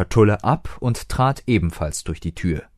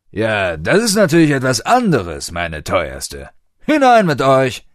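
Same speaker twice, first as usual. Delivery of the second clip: charmed bouncer; 4 semitones higher, 5 dB louder than usual. A narrator's reading. Bouncer charmed, lets them in.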